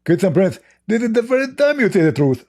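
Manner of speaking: squeaky mock rival, smug and pleased; mocking Zakir Naik. Debater mocking his rival by answering in his voice, high and squeaky. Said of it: "What do you think, Zakir Naik?".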